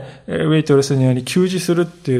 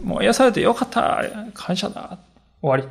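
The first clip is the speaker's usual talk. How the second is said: higher, softer, tossed-off "もう癒されてよかった", fading on 終わり; offhand dismissal; 4 semitones higher, 5 dB quieter than usual. Acted. Healed man closing the matter, offhand and dismissive. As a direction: brush it off as done and over. Dismissive, quick thanks then done.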